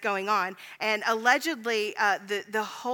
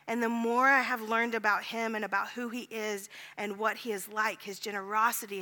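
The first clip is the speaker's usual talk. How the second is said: ordinary delivery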